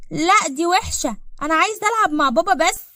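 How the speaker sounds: put-on high, loud whining kid protest; sulky child. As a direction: refuse like a stubborn little child. Kind lehnt die Fremde ab.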